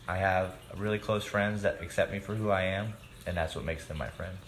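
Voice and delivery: usual speaking voice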